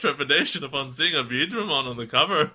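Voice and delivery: reading aloud through giggles; laughing while reading. Real, not acted. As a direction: keep cracking up as you read.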